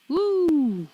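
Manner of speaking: high, excited cheer; cheering woo. Real, not acted. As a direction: whoop for the big break. Cheering the break.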